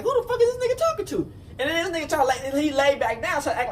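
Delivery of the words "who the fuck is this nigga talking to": very high, laughing outrage; who does he think he is; indignant disbelief. Retold or imitated. Sitting up in the bunk, high laughing outrage.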